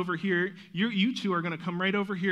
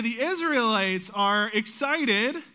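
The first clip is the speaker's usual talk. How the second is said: slow, louder, higher cue for a cheer; excited cue; 5 semitones higher, 5 dB louder, slower than usual. Shown as a demonstration. To the kids playing Israelites, a slow excited cue. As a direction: draw the words out to get the kids cheering. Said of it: "Now, if I say".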